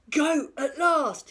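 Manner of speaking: high, loud and slow "Goat at last!"; a hungry troll thrilled to find tracks; excited cry. A narrator's reading. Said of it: "he cried".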